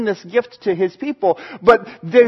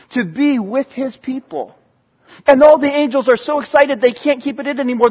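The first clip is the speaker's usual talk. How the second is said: normal talking voice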